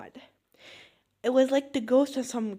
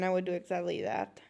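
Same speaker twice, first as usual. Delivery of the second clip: normal talking voice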